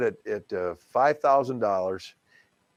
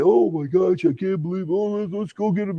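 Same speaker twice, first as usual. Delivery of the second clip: excited bidders; 8 semitones higher than usual. Retold or imitated.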